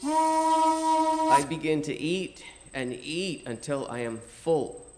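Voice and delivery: ordinary delivery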